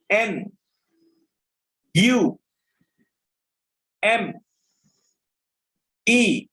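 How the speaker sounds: slow classroom dictation, one letter at a time. Acted. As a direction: call out each letter clearly for the class to copy.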